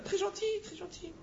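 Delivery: high cooing praise; mock sweet emphasis. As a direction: gush over how nice she is, tongue in cheek. Describing a nice lady.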